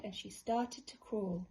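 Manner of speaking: ordinary delivery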